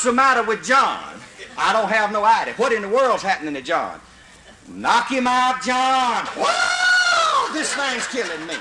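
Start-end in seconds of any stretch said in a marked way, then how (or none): 4.7-6.2 high shout urging John on; a hunter shouting up to the treetop; calling up the tree. Retold or imitated. 6.4-8.5 very high yowl, then a pained cry; John yelling from the treetop, attacked; panicked squall. Retold or imitated. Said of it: "You can hear John squall".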